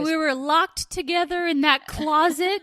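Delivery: high, loud, hammed-up Southern drawl of a soap heroine; mock Southern American accent. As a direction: ham up a gushing Southern American confession. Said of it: "What is this accent?".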